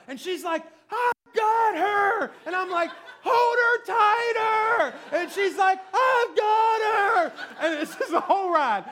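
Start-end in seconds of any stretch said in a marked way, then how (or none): 0.9-2.3 very slow, high strained yell; wife shouting back. Retold or imitated. Wife answering husband on the ride, screaming back. 3.2-5.0 slow, drawn-out high yell; frantic shouting. Retold or imitated. Husband to wife on the ride, desperate screaming. 5.9-7.3 high, long-stretched comic yell; wife screaming back. Retold or imitated. Wife reassuring husband on the ride, screaming back.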